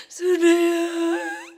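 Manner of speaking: slow, drawn-out tearful call; a weeping whisper of a name; mother crying. Retold or imitated. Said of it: "엄마가 그렇게 울더라고요". (흐느끼며 부르는 이름)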